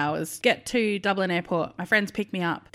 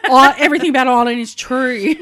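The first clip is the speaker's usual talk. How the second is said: much louder, higher cry of discovery; amazed exclamation; 5 semitones higher, 11 dB louder than usual. Retold or imitated. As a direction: shout it out in delighted astonishment. Retells delighted astonishment at potatoes.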